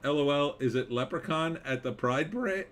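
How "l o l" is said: ordinary talk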